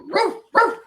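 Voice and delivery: high silly barking roof; dog bark. Retold or imitated. Tom imitates a dog barking in a joke, high yap. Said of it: "he's going".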